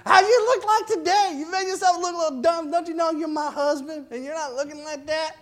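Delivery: high scolding sarcastic wife. Acted. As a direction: nag him, high and biting. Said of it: "a sarcasm there with her voice".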